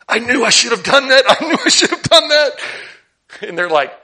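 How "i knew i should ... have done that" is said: higher, louder mock regret with a laugh; mock apostles kicking themselves. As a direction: play the disciples groaning at the missed chance, half laughing. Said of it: "they were probably all like".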